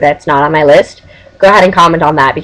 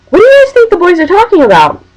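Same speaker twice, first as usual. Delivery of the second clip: imitating a friend; 12 semitones higher, 3 dB louder than usual. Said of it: "Allison says".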